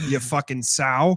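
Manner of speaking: usual speaking voice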